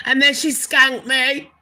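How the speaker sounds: loud indignant exclamation; outraged. Real, not acted. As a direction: throw it out like a scandal. Outraged gossip about betrayal.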